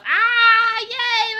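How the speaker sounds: high, loud happy squeal; excited cheer. Real, not acted. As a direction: burst out with real delight. Happy about playing D and D.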